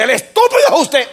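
mock yell of a parent answering in kind; parent yelling back. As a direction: throw the insult right back, loud. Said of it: "y usted responde".

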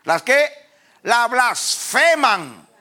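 slow, high, loud pulpit declamation; full-force preaching. Real, not acted. Preacher expounding Judas on rebels to the congregation, full-force declamation. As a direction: stretch and thunder each phrase, building.

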